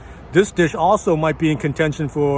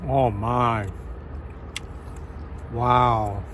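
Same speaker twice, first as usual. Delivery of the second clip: awed murmur; 6 semitones lower, 6 dB quieter, much slower than usual. Real, not acted. First bite of beef shank.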